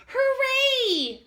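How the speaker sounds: put-on high, loud child's cheer; cheering hooray. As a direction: shout it out like an excited little kid. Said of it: "clapped Chester".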